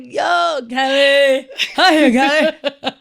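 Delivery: loud, drawn-out mock drunk greeting; mock drunk caller. Friend mocking the drunk caller's voicemail to Kelly, loud and drawn out. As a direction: call out to Kelly like a drunk guy. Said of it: "He was like".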